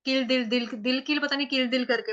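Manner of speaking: ordinary delivery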